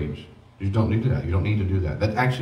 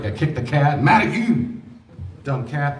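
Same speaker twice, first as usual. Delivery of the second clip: hammed-up outburst, jumping higher on "Mad at you"; mock angry venting; 4 semitones higher, slower than usual. Someone at day's end venting at the cat, mock angry. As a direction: play at taking it out on the cat.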